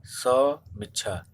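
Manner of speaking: "समीक्षा" very slow, broken after the first syllable; drawn-out word. Shown as a demonstration. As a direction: spell out "समीक्षा" slowly, as sa... miksha. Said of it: "ऐसे ना होके क्या हो जाएगा".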